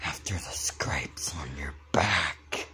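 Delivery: low spooky whisper; creepy whisper. Acted. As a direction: hush it like a ghost story. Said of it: "Doing that voice".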